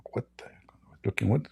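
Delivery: whispered, baffled aside; a bewildered whispered what; startled whisper. Retold or imitated. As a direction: hush it out, confused. Startled reaction on empty beach.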